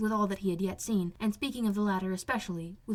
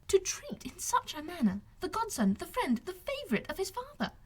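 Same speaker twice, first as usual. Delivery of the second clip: higher, indignant exclamation; exclaimed; 10 semitones higher than usual. Acted. Elizabeth exclaiming at Darcy's treatment of Wickham, high and indignant. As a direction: burst out, outraged on his behalf.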